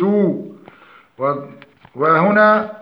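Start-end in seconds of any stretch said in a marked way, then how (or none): none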